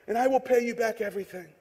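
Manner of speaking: soft, earnest plea; begging. A narrator's reading. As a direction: beg quietly, promising. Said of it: "he begged".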